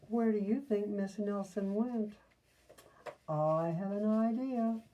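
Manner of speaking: usual speaking voice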